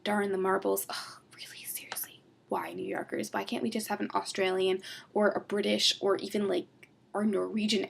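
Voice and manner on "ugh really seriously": whispered groan; whispered annoyance; exasperated whisper. Real, not acted. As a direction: sigh it out in a whisper.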